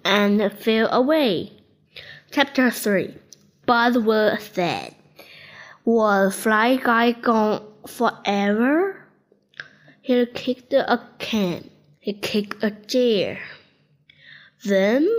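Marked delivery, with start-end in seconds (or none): none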